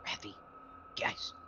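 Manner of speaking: urgent hushed whisper. Acted. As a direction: whisper so the strangers can't hear. Waking party, enemies near.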